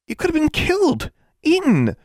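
very high, horrified exclamation; gasped horror. A narrator's reading. Friend hearing about the cat's teeth, gasped horror. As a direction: gasp it out high, appalled. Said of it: "she gasped".

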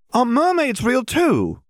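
very high, slow, wondering question; eager child interrupting. A narrator's reading. Hermione interrupting the adults for answers, eager. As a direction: butt in with high-pitched childlike excitement. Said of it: "interrupted Hermione, still hoping".

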